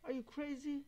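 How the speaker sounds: a sharp high mock "are you crazy"; mock outrage. Mimicking people angry at a slur, high and shocked. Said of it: "They say".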